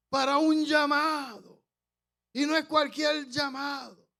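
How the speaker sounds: high, loud proclaiming voice, slow at first; impassioned prophetic shout. Retold or imitated. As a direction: cry out the calling like a prophet. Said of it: "Pero hablando con este tono".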